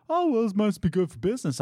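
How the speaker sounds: high, quick, snide mock; snide remark. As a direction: sneer it like a smug customer. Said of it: "that snide remark".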